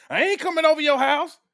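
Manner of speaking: high, loud indignant yelling; outraged shout. Retold or imitated. Imitate a woman refusing a real threat, yelling. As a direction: shout it like she'd really refuse.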